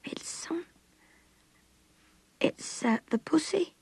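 put-on high, halting child's voice; tearful child struggling to get words out; crying little girl. A narrator's reading. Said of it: "cried the girl".

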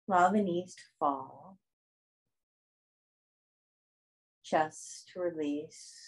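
soft, spacious yoga nidra guidance; guided meditation. Acted. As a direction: guide them unhurriedly through breath and body, softening as you go.

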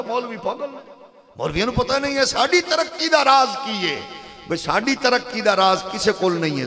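loud, forceful sermon delivery; fiery preaching. Real, not acted. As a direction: preach it at full force to rouse the crowd.

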